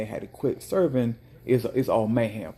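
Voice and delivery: ordinary talk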